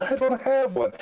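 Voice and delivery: high-pitched amused denial; playful protest. Real, not acted. American guest denies having an accent, playfully protesting. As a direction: protest it, voice shooting right up.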